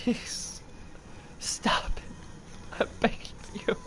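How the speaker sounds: soft, breathy pleading; defeated whisper; whispered begging. Real, not acted. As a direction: beg under your breath.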